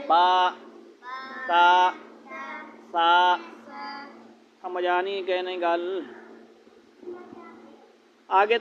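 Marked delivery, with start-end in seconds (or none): 0.1-3.3 measured drill of با، تا، سا; one alif long. Shown as a demonstration. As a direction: stretch each letter one beat. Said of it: "ایک الف کے برابر".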